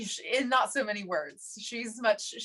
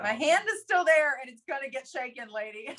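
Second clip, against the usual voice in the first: mock insistent; 5 semitones higher, 3 dB louder than usual. Insisting she shake her hand.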